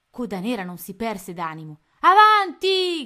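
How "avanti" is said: very loud, high, slow yell; roared command. A narrator's reading. Captain urging his men forward, roared command. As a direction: bellow at the crew to charge. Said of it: "gridava ai suoi uomini".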